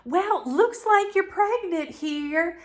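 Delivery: high, slow, put-on chipper voice; imitating the caller. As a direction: sing it out slowly like cheery staff. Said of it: "the gynecologist calls".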